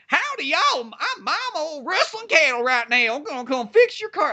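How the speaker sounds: high-pitched cartoon Southern twang; hammed-up Southern accent. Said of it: "give her that fucking accent".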